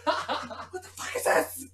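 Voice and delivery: high, slow laughing outrage; laughing disgust. Retold or imitated. Imagined NPC reaction, laughing.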